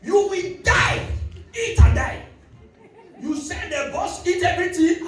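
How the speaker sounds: high, loud, slow furious yelling; woman raging over her bus; angry shout. Retold or imitated. Angry woman in phone booth.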